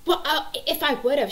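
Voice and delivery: high, loud whiny comeback; imitated protesting child. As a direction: mimic a kid arguing back. Daughter protesting back to mom.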